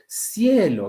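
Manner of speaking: "Cielo" pitched high on the first syllable; stress on CIE. Shown as a demonstration. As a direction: lean hard on CIE in "Cielo". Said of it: "acá está la mayor fuerza de voz".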